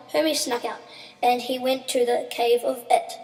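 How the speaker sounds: usual speaking voice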